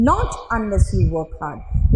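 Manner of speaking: not leaned on, very slow; slow, heavy not at the front; not drawn out slowly and stressed. Shown as a demonstration. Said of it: "देख रहे हो, जोर दिया".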